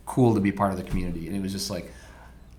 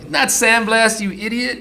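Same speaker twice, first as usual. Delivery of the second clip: high, louder mocking scold; scolding German; 13 semitones higher, 8 dB louder, slower than usual. German mechanic scolding the kid, high mock scold. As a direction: talk down to the idiot kid. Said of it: "He was German".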